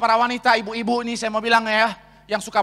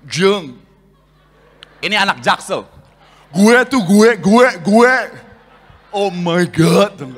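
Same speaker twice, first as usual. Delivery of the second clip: put-on Jakarta slang girl voice, pushed louder; Jaksel girl impression; 3 dB louder, slower than usual. Preacher mocking a Jaksel girl, loud put-on voice. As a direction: ham up a trendy South Jakarta girl. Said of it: "ini kan impersonate, nggak boleh setengah-setengah".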